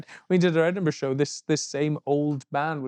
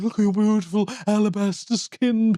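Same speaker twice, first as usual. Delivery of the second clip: higher, gushing, flowery admirer voice; eloquent stalker; 5 semitones higher than usual. Retold or imitated. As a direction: lay it on like a poetic devotee. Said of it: "He's like".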